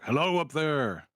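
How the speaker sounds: loud, slow call upward to the sky. Acted. Calling up to the bat rider overhead, loud and slow. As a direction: shout up to a distant flyer. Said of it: "call out to it".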